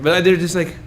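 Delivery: ordinary talk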